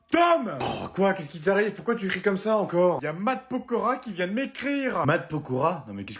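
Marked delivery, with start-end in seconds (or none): 0.1-0.6 shrieking the son's name much higher and louder; calling out. Acted. Excited mother yelling for her son Tom. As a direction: yell the name across the house.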